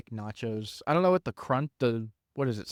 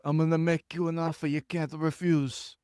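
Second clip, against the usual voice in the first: a hint of a mob boss, lightly voiced; Godfather impression; 2 semitones higher than usual. Host quoting the Godfather in a light mobster voice. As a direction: rasp it like the Godfather. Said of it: "we both went to Godfather".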